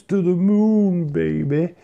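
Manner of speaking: loud, high, hyped-up catchphrase; hyped catchphrase shout. Acted. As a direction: belt it out like a fan chant.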